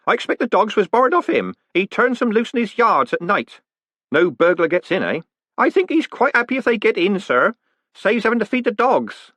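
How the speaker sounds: put-on high-pitched, deferential old postman; chatty old Groat. A narrator's reading. Groat explaining the dogs to Moist, chatty old postman. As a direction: pipe up high, chattily informing the boss.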